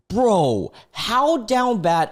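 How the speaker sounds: incredulous. Real, not acted. Mocking the poster.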